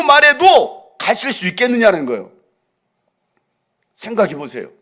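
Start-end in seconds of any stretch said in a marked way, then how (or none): 0.0-2.3 loud, soaring preacher voice; full-force preaching. Acted. Preacher crying out to the congregation, loud and soaring. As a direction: preach it at the top of your lungs.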